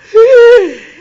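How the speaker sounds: loud high gasp of recognition; sharp surprised gasp. Retold or imitated. Old friend on the phone hears who's calling, loud gasp. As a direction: gasp as if hearing a long-lost friend.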